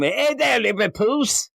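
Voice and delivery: high-pitched, put-on Liverpool accent; bad Liverpool accent. Dreaming he's in Liverpool, badly faked Liverpool accent. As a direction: greet them in a hammed-up Liverpool accent. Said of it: "with the Liverpool accent".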